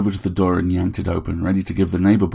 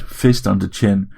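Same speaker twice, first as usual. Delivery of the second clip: ordinary delivery, nothing marked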